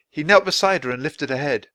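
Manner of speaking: usual speaking voice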